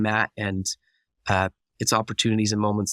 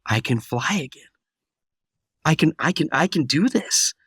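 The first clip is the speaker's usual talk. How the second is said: emotional realization; 5 semitones higher than usual. Retold or imitated. Pilot realizing he can fly again, rising with emotion. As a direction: let the hope break through, building. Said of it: "I, I started crying".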